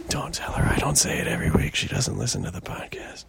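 conspiratorial whisper. Real, not acted. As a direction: whisper it behind her back. Aside about his listener.

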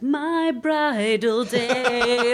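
high, drawn-out mock gushing; gushing bride. Guest mocking wedding-obsessed brides, gushing. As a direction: stretch it out like a dreamy bride-to-be. Mocks lifelong wedding-dreamers.

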